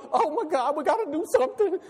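high, rushed imitation of worked-up Christians; mock panic. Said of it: "they get worked up".

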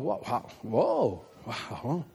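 low, drawn-out mimicked amazement; mock stunned reaction. Preacher mimics congregation reacting to tonight's words, low stunned mock. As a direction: imitate a taken-aback listener, softly and slowly. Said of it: "some of you going".

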